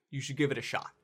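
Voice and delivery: usual speaking voice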